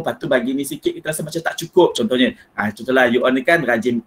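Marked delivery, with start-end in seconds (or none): none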